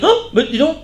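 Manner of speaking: high startled exclamation; mock surprise. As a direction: pretend to stumble on a surprising find. Acting president finding the old email.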